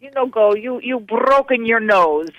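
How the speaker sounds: mock-warning in an Italian boss voice; Dino's Italian accent. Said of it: "I'm doing it with his Italian accent".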